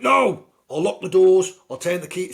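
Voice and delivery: high, loud battle-axe granny voice; bossy old woman. Retold or imitated. Old babysitter refusing to let the teen out, loud and high. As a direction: bark it like a strict old babysitter. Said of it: "an absolute bitch of an old woman".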